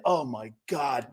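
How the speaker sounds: normal talking voice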